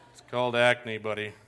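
low, soft, self-conscious reply; imitated awkward, subdued teen; embarrassed teenager. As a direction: mumble it to shoo a staring kid away. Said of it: "And I was all embarrassed".

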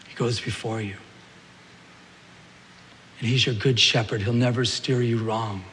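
soft, low and gentle; tender reassurance. Real, not acted. Pastor comforting the congregation, soft and tender. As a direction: reassure them quietly, like a shepherd.

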